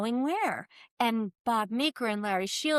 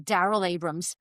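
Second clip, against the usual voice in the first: "Daryl Abrams" in a New York accent, vowels broadened; 6 semitones lower than usual. Shown as a demonstration. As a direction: say "Daryl Abrams" like a New Yorker, lower pitch. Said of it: "like, a New York accent".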